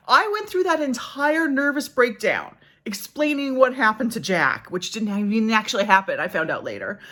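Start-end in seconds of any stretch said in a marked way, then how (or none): none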